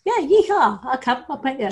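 high, swooping gleeful yeehaw; eager whoop. Retold or imitated. Wife jumping at a trip to lavish parties, eager whoop.

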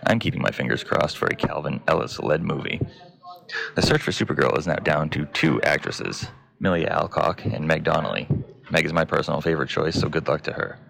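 low, even newsreader voice; news read. Acted. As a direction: read the headlines like a news anchor.

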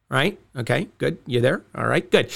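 soft, low check-in; quiet aside. Acted. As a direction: drop down and murmur to the listener. Pretending to wait for listener.

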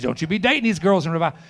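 loud, forceful imitation of a scolding elder; stern warning. Older preacher warning young evangelist, imitated stern warning. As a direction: boom it out as an emphatic warning.

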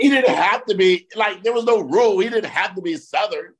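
high, incredulous comic protest; amused exasperation. Real, not acted. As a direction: protest it while on the edge of laughter. Amused rant about needless accent.

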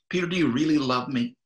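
urgent repeated question from Jesus; pressing question. Retold or imitated. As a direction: push him harder this time. Jesus presses Peter again.

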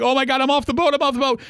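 high-pitched retold panic; freaking out. Rider being lifted off the boat, mock freaking out. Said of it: "I'm freaking out".

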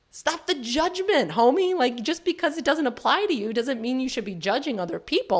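high, playful telling-off; mock fired-up scolding. Telling off people who judge her health worries, playfully indignant. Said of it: "I'm making light of this".

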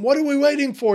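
high, loud trainer's holler; rousing shout. Acted. Trainer behind Rocky urging him on, rousing shout. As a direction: shout it to spur the fighter on. Acting Mickey's line from Rocky.

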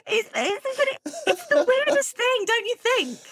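high-pitched, giggly disbelief; laughing through words. Real, not acted. Host laughing with guest over how weird it is, laughing. Amused aside about plasticine penguin.

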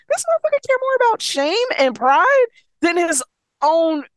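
high-pitched outraged quote; Gwen's indignant reaction. Retold or imitated. Reader voices a mate outraged over shame and pride, high indignant pitch. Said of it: "that was Gwen's reaction".

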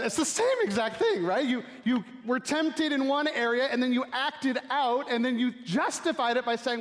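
preacher cranking it up high and loud; excited preaching. Real, not acted. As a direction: drive the point home, rising. Preacher building to a point.

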